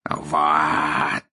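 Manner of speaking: low, drawn-out exclamation of triumph; gloating, one long emphatic word; triumphant. A narrator's reading. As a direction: declare it as final proof. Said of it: "с торжеством сказал Мракс".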